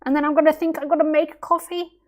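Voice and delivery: high, quick machine-like voice; robotic programmed thought. Retold or imitated. Teacher mocking a programmed coffee thought, high and quick. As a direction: read it like a computer script. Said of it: "did you program in that thought".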